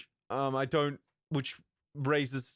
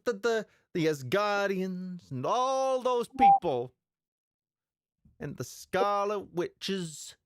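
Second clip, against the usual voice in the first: put-on a higher, lingering Brooklyn accent; 5 semitones higher than usual. Explaining trailer lore to viewers, in a Brooklyn accent. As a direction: still stuck in the Brooklyn accent. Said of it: "what's with the Brooklyn accent?".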